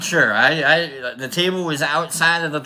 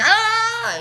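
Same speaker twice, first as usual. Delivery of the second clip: very high, loud startled yelp; 3 dB louder than usual. Retold or imitated. Man yelping back loud and high at the mouse. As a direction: yelp back in a mock panic. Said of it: "I'm like".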